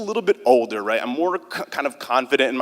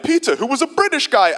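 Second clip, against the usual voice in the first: a much higher, hammed-up British accent; overdone fake British accent, pitched way up; bad British accent; 10 semitones higher than usual. Shown as a demonstration. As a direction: attempt a British accent you can't really do. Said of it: "never been able to do a British accent".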